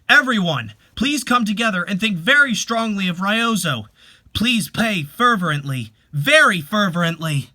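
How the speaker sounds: loud, earnest call to pray; rally the whole family to pray hard; urging the family. Acted. Leader urges family to pray.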